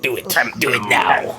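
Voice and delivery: mock evil emperor urging someone on; Emperor Palpatine. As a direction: play the scheming emperor. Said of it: "familiar with the name Emperor Palpatine".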